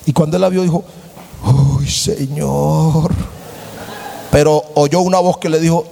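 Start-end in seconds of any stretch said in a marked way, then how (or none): 1.8-3.1 very slow, low "Uy, Señor"; admiring sigh. Acted. Young man at church smitten by the girl in red, drawn out. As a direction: let it melt out, smitten.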